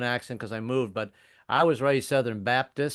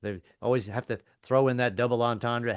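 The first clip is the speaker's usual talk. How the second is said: ordinary delivery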